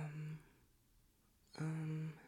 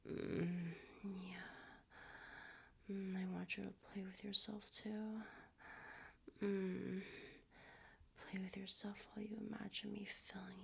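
sultry whisper; 2 semitones higher, 6 dB quieter than usual. Acted. Creator talking to an imagined partner in a teasing whisper. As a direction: murmur it close to the mic like a tease.

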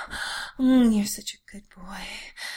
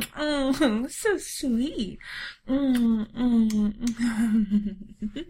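ordinary delivery

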